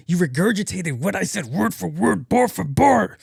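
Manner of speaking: building to a high, loud accusation; hammed-up indignant rant; mock indignation. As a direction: climb in pitch and volume in outrage. Acted indignant rant at brother.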